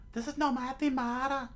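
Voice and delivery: high falsetto scolding; mildly indignant high voice; angry young woman. Acted. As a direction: snap crossly in a put-on female voice. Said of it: "to which Nami, uh, showed anger".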